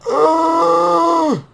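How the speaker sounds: high startled shriek. Real, not acted. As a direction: let out a sudden shocked high scream. Reacting to video, shout heard.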